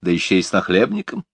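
usual speaking voice

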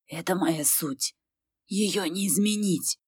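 soft, slow confession; quiet resigned. Acted.

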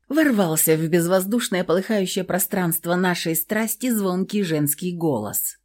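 ordinary delivery

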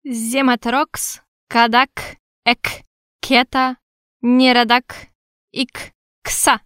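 slow, pompous foreign words; pompous king. A narrator's reading. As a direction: pronounce it self-importantly, like a dwarf king. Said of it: "важно произнёс король".

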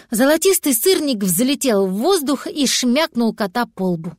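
ordinary delivery

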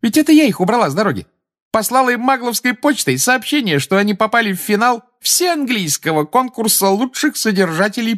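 put-on very high-pitched young woman's voice; high, animated bragging about a trick; lively young witch, gleeful. A narrator's reading. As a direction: chatter gleefully in a high girlish voice.

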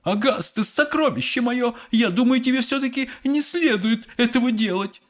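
high, fussy mother voice; Mrs Gloop. A narrator's reading. Mother warns her treasured son, high fussing. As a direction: chide your darling in a high voice. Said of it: "раздался крик миссис Глуп".

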